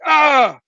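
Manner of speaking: high "ahh" of alarm; startled yell. Retold or imitated. Spider-Man facing the vampire, high startled yell.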